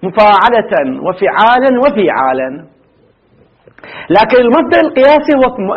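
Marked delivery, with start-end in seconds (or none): 0.0-2.6 the patterns مفاعلة and فعالا said slowly, each vowel clear; slow pattern recital. Shown as a demonstration. Teacher dictating patterns to students, slow and careful. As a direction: spell out each verbal-noun pattern slowly for the class. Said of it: "مصدران آخران".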